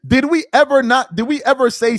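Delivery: loud, forceful rebuttal; loud, outraged rhetorical question; indignant challenge. Real, not acted. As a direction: fire the question back loudly, indignant. Angry rebuttal of the played clip.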